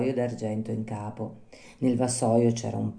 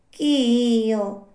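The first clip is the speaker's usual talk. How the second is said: high, drawn-out little snail voice; slow snail; 10 semitones higher, 4 dB louder than usual. Acted. Snail calling down to a night caller, slow snail voice. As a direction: stretch each word like an unhurried snail.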